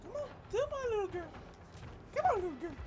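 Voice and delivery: very high, small coaxing voice. Pretend. Player urging the girl to move, high coaxing. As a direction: coax her along in a tiny high voice.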